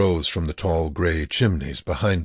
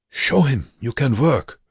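higher-pitched insistence; urgent prodding; 8 semitones higher, 4 dB louder than usual. A narrator's reading. Prisoner pushing Joseph to work, urgent raised voice. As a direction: urge him with raised pitch.

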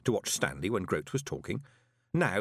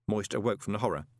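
ordinary delivery, nothing marked

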